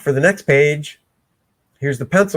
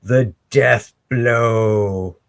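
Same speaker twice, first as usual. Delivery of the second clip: menacing villain; 4 semitones lower, slower than usual. Retold or imitated. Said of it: "He's like".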